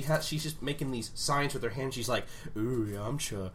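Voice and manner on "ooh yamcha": seductive voice. Retold or imitated. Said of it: "that's what she sounds like".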